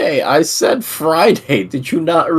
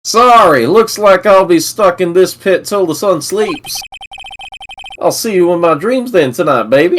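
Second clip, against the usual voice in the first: higher-pitched put-on southern accent; 6 semitones higher than usual. Game character saying goodnight, southern accent. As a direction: play it country, in a Southern accent. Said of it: "Is this your southern accent?".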